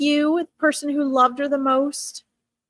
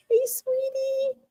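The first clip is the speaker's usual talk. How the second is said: much higher, slow, cooing "Hey, sweetie"; sweet grandmother voice; 13 semitones higher, 5 dB quieter, slower than usual. Retold or imitated. Grandmother greeting the dog spirit, high and slow cooing. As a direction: coo it like a doting grandma.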